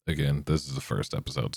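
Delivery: ordinary talk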